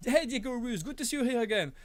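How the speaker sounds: normal talking voice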